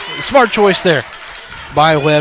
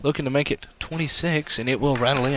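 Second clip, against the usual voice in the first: hushed; 8 dB quieter than usual. Acted. Hushed free throw call.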